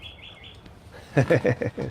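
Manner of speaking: short high laugh; breathy laugh after shouting. Real, not acted. Laughing after yelling at a squirrel. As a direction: laugh at the interruption.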